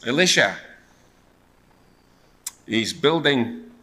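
ordinary delivery

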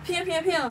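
high little imitated gunshot noises; Instagram girl's pews. Imitating the girl from Instagram, high pew noises. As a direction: copy a catchphrase sound, light and high. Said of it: "that do the pew".

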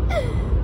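high, quick mock gasp of pain; dramatic gasp. Mimicking a dramatic kid patient gasping. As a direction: gasp like an overdramatic child. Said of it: "the real dramatic ones that be like".

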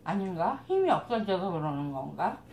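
talking between bites; speaking around food; mouth full, chewing. Real, not acted. Seen 3: Food taken at -4.0; cheeks bulge and jaw chews while talking.

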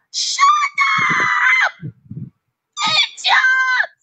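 high, drawn-out screamed insult; furious retold shout. Husband shouting down his wife in a quarrel, furious high shout. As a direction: scream it at the top of your voice.

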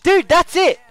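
loud, high and exasperated; worked-up exclamation. Real, not acted. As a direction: raise the voice in excited protest. Worked up over donations popping up.

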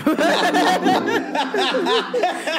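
big high-pitched laughing burst; loud high laugh. Real, not acted. Laughing at Cucumber Man, loud high laugh.

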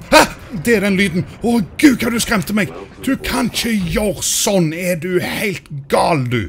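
real fright; shrieking fright. Real, not acted. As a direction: shriek at the creature that scared you. Scared by monster sound.

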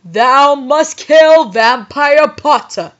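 loud, slow villain decree; yelled command. A narrator's reading. Voldemort ordering the heroine to kill, grand yell. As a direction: declaim it grandly and slowly. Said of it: "he yelled".

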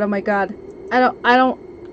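normal talking voice

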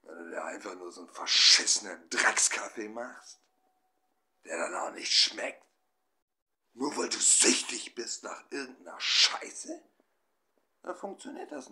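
loud, scornful outburst about the bad coffee; loud mocking rant about the junk coffee; disgusted rant. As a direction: spit the curse words out loudly. Ranting about drinking bad coffee.

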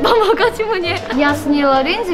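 ordinary delivery

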